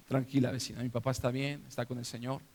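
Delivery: low, hushed reassuring voice; calm her down gently, keeping it low; quiet reassurance. Retold or imitated. Retold reassuring of a weeping neighbour.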